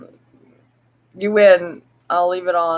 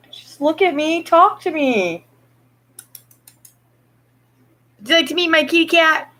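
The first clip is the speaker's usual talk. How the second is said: pet talk; 9 semitones higher than usual. Real, not acted. Owner coaxing her cat to look, high pet talk.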